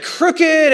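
"crooked" thrown up high and loud; emphatic crooked. Real, not acted. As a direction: hit "crooked" hard, disbelief. Self-mocking his crooked tiling.

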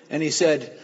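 normal talking voice

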